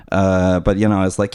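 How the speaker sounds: usual speaking voice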